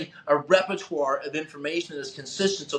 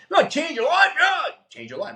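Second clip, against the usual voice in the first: high, loud sarcastic parody of the speaker; mocking hype shout; 11 semitones higher, 4 dB louder than usual. Driving around parodying the speaker, high mock shout. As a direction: ham up the slogan to ridicule it. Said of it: "I'm driving around Bellevue going".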